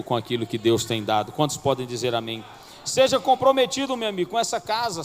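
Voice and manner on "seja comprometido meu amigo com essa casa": raised, higher preaching call; preaching exhortation. Acted. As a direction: urge the church to commit. (pregação exaltada, mais alta)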